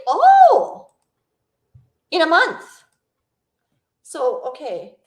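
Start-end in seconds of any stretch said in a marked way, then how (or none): 0.1-2.6 very high, loud, drawn-out gasp of news; real surprise. Real, not acted. Streamer hearing the cruise date, really surprised.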